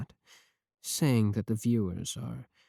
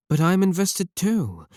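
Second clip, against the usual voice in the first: higher, louder sudden insistence; insistent outburst; 8 semitones higher, 6 dB louder than usual. Acted. Streamer defending her love to the viewer, raised and insistent. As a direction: blurt it out defensively.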